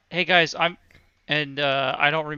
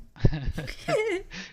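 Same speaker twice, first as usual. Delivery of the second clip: high laugh. Real, not acted. Laughing at the accent intro.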